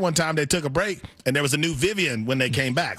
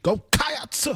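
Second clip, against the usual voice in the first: "Cats" much higher, stretched into two syllables; preacher-style "Cats"; 10 semitones higher than usual. Acted. Co-host attempting the cheer, preacher-like. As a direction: wail "Cats" like a Sunday sermon.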